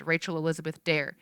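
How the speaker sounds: ordinary delivery, nothing marked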